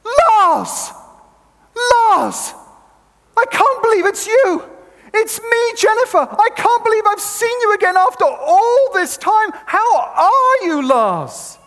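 high, loud excited shout across the platform. Retold or imitated. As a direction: bellow his name, overjoyed to see him. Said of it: "She shouts".

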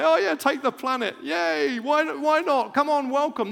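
high, loud sarcastic welcome; mock cheerful surrender. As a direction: play the enemy as a gushing pushover. Enemy mockingly surrenders the planet.

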